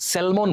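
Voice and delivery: স্যালমন with the l sounded, "sel-mon"; common mispronunciation. Shown as a demonstration. Teacher showing what we call the fish, mispronounced. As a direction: stress the l in স্যালমন. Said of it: "যাকে আমরা কি বলি".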